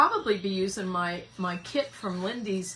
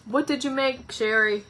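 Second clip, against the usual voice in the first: ordinary talk, nothing marked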